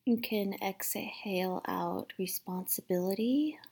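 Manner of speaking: gentle guided meditation voice, partly whispered; calm, hushed meditation guide. Acted.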